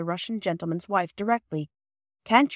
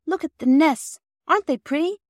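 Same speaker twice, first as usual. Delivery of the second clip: put-on high, louder child's delight; 10 semitones higher, 5 dB louder than usual. A narrator's reading. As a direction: show off the pretty nests, thrilled. Phyllis delighted, reaching out.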